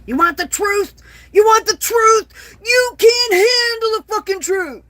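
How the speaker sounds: high, loud, shouted courtroom rant; Jack Nicholson impression. Mocking TikTok's censors with a loud, high Nicholson yell. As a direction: bellow it like the famous movie colonel losing it on the stand. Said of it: "In my best Ni-, Nicholson voice".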